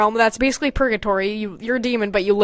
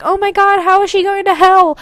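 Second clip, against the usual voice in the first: high, loud distraught cry; a raised, panicked oh my God; 7 semitones higher, 4 dB louder than usual. Acted. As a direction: sound horrified at the news. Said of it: "my character's very distraught".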